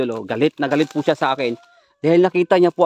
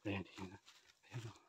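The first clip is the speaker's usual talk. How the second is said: hushed; 7 semitones lower, 29 dB quieter, much slower than usual. Real, not acted. Watching the witch approach.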